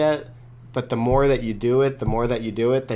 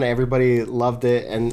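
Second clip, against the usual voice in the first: ordinary talk, nothing marked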